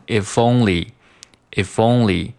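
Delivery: "if only" joined, f starting "only"; linked "if only". Shown as a demonstration. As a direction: slide the f of "if" into "only". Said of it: "if和only可以进行连读". (连读if only)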